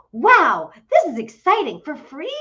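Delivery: loud, high, thrilled; excited. Retold or imitated. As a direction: gush with delight. Thrilled about free espresso.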